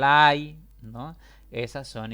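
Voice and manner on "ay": "ay" cried out loud, higher; pained ay. Shown as a demonstration. Teacher shows the cry "ay" at full volume. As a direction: shout "ay" like a sudden pain. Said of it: "o usamos el".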